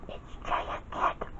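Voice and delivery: hushed, slow whisper; barely audible character whisper. A narrator's reading. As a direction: whisper it under your breath. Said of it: "whispered Spinel".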